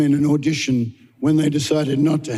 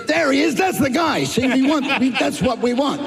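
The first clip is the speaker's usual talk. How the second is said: imitating Lee; 10 semitones higher than usual. Imitating Lee's excitement.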